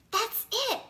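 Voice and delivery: sudden high burst of an idea; light up with the answer; excited realization. Acted. A boy hearing the TV advice, excited.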